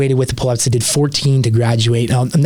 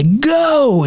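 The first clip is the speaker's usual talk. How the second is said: sudden high yelled command; instructor's shout; 13 semitones higher than usual. Retold or imitated. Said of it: "Instructors say".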